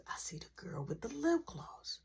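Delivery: hushed aside, barely voiced; whisper. Real, not acted. Telling viewers about the girl, whispered. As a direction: whisper it like a secret.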